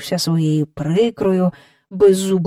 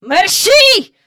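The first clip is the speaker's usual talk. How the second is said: put-on high, croaky old man's command; urgent rasping command to seize her; stern order; 10 semitones higher than usual. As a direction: shout orders as a stern great-grandfather. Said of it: "пролунав суворий наказ прадіда".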